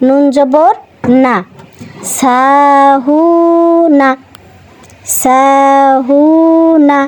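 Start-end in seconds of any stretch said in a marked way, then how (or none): 2.2-4.1 সাহুনা very slow, the হু held long; slow drawn-out recitation. Shown as a demonstration. Teacher reciting সাহুনা slowly for pupils. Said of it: "teacher demonstrating madd lengthening". 5.2-7.0 সাহুনা very slow, the হু held long; slow drawn-out recitation. Shown as a demonstration. Teacher reciting সাহুনা slowly for pupils. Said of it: "repeats word slowly for pupils".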